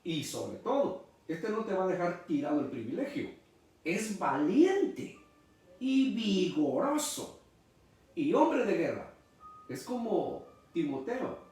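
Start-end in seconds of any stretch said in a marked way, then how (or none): 3.8-9.1 fervent build. Acted. Preacher extolling David's strength.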